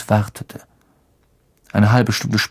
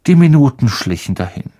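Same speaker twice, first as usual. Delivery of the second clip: ordinary delivery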